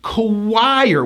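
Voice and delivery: Choir as kuh-WIRE, high I; Choir, drawn out. Shown as a demonstration. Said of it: "with a high I going to an R".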